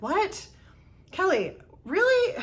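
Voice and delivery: incredulous, dragging out really; shocked disbelief. Retold or imitated. Coworker stunned to learn where she's worked, incredulous.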